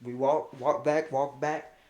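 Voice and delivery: usual speaking voice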